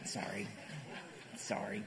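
soft, sheepish sorry; low sheepish sorry; quiet apology. Real, not acted. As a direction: murmur it, embarrassed. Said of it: "I should stick to my notes".

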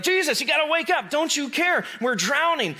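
high, urgent cry of alarm; panicked plea. Acted. Disciples shaking Jesus awake, high and panicked. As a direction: sound like scared men shaking someone awake.

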